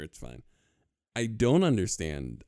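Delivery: ordinary delivery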